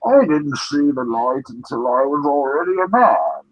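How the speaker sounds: put-on villain voice climbing to a swoop; theatrical movie-villain impression; Bane. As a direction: build in pitch toward the last words, then drop. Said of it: "do your Bane voice".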